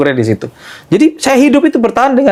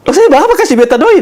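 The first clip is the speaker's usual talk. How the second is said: put-on high and louder Ambon accent with a laugh; 13 semitones higher, 4 dB louder than usual. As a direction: laugh through the Ambonese line. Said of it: "Pakai logat Ambon kan".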